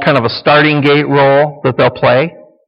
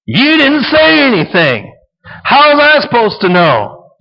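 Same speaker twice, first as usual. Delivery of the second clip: defensive husband; 10 semitones higher than usual. Acted. Husband wrangling with wife, mock indignant. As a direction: argue back in a hammed-up whiny voice. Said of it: "they're wrangling".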